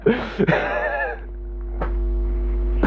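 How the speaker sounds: a long airless laugh rising in pitch; real giggle; breathless laugh. Real, not acted. Laughing at herself after dancing on video, airy giggle.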